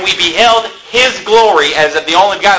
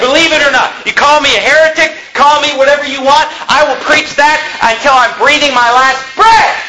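raised pitch, unyielding declaration; defiant preaching; 5 semitones higher than usual. Real, not acted. Preacher answers those calling him heretic, defiant preaching. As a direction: preach it defiantly, driving to the last word.

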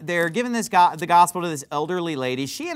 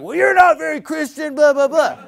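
much higher, louder mock of the old guy; mocking whiny shout; 9 semitones higher, 6 dB louder than usual. Preacher mimicking old guy calling him unchristian, mocking shout. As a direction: sneer it in a put-on indignant voice. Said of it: "he's just like".

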